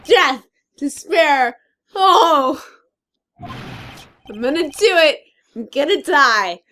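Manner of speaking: melodramatic despair. Acted. [name] voices despairing Larry.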